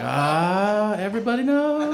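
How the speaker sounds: high, whiny realization; the dread of being found out; mock despair. Him seeing his parents arrive, high whine.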